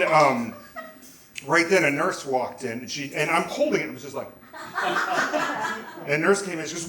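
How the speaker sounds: ordinary talk, nothing marked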